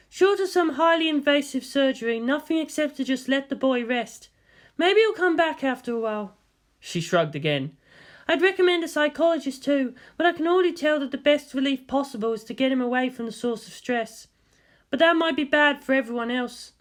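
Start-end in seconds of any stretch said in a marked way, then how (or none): 0.1-6.4 higher, lightly voiced female scientist; woman doctor voice. 8.3-16.7 higher, lightly voiced female scientist; woman doctor voice.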